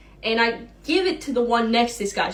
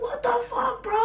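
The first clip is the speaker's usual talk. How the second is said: high whining what the fuck bro; disgusted bro; 13 semitones higher, 5 dB quieter than usual. Retold or imitated. The customer whining at the waiter, pitched high. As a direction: whine it like an offended bro. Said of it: "And he's like".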